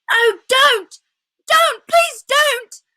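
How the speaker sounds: very high, loud pleading shout; desperate shout. Acted. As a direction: beg her to stop at the top of your voice. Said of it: "shouted Edmund".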